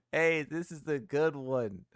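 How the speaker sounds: "Hey, this is a good one" repeated slowly at normal level, still chuckling. Real, not acted. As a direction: say it again to yourself, amused and slow. Repeating meme praise.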